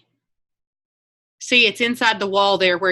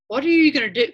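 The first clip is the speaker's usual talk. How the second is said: higher, lightly imitated mom's question; wondering out loud; 6 semitones higher, 4 dB louder, faster than usual. Mom asking daughter about changes, wondering out loud. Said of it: "she was just won-, wondering out loud".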